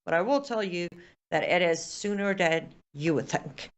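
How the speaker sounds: ordinary delivery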